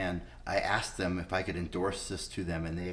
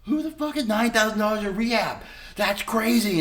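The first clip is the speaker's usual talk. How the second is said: incredulous outburst; 11 semitones higher, 6 dB louder than usual. Retold or imitated. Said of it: "And I'm like".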